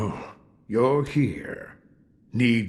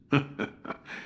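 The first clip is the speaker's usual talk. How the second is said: quiet chuckle; 5 semitones higher, 5 dB quieter than usual. Acted. Old man chuckles at memory.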